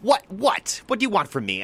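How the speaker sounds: high, loud incredulous rant, imitated; Silken Lemon berating. Silken Lemon tearing into the reporter, high loud incredulity. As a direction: demand an answer, half laughing at the absurdity. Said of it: "berating the reporter".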